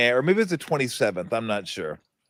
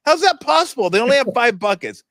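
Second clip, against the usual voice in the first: much higher, louder, mock disbelief; incredulous disbelief; 7 semitones higher, 3 dB louder than usual. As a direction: act out his outrage at the stat sheet, higher. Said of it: "I'm like".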